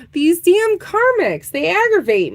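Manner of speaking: fed-up groan of words; exasperated complaint. Real, not acted. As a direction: drag it out in mock annoyance. Repeats complaint more strongly.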